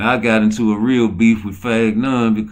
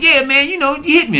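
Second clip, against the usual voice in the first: much higher, timid mock of the host asking; imitating a meek little voice; 5 dB louder than usual. Mocking the host's timid ask, much higher voice. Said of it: "He's like".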